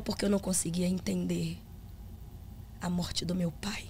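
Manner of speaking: hushed, unvoiced whisper; whispered confession. The husband confiding his grief, in a whisper. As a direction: whisper it like a secret.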